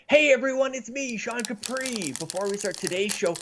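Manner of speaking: bright, high show-opener voice; upbeat host intro. Acted. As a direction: greet the audience like a host.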